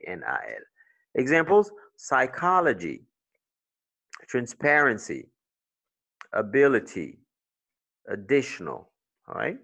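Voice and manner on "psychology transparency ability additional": "psychology", "transparency", "ability", "additional" punched on CHO, PAR, BIL, DI; one word at a time, stressing the second syllable of "psychology", "transparency", "ability", "additional". Shown as a demonstration. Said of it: "On the second syllable".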